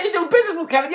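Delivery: high, loud disbelief; amazed question. Retold or imitated. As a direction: ask it like you can't believe it. Retelling his amazed reply.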